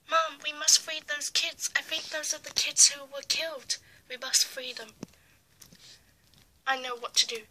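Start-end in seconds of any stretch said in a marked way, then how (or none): none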